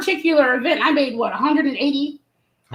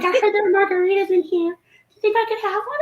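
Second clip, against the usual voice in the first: sweet small voice; 6 semitones higher than usual. Retold or imitated. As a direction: ask sweetly and shyly. Guest asks for a margarita.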